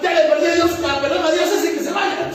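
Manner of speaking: high, loud scoffing sinner; loud mocking caricature of a careless person; mock dismissive. Preacher mimics a dismissive person.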